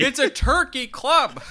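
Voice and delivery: high, loud laughing protest; hammed-up indignation; mock outrage. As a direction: cry out in comic disbelief at a botched order. Joining the outraged sandwich bit.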